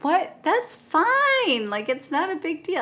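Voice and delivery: very high squeak, brushing it off; hammed-up surprise then casual dismissal; mock shocked then shrugging. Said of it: "And they're like".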